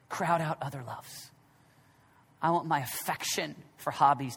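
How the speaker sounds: low, soft and intimate, almost confiding; soft prayerful preaching. Acted. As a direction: draw them in quietly. Soft reflective opening of sermon.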